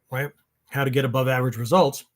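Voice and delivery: ordinary talk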